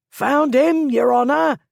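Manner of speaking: high, loud triumphant shout from the crowd. A narrator's reading. Crowd member shouting to the others, found the lord, loud shout. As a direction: yell it out as you spot your quarry. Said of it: "somebody shouted".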